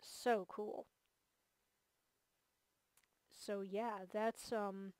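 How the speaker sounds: ordinary talk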